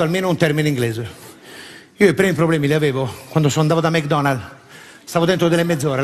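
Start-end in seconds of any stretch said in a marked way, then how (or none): none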